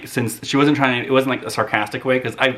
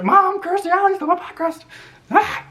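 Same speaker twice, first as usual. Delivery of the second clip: put-on much higher, whining child's cry; whiny kid tattling. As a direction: tattle to mom about the stolen pie.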